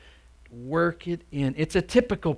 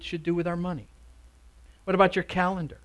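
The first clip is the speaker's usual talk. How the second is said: ordinary talk, nothing marked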